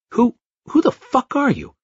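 high-pitched stammering panic; terrified driver stammers at the stranger; panicked demand. A narrator's reading. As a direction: blurt it out in fright. Frightened driver confronts stranger.

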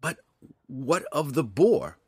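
high, slow, bewildered question; stretched-out confused query; puzzled. A narrator's reading. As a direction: ask in baffled surprise. Puzzled drow asks about the boar.

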